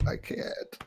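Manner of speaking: whispered, voice completely lost; can only get out a whisper; voice gone. Real, not acted. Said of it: "That sounds miserable".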